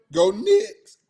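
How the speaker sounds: voice cracked. Real, not acted. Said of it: "I mean".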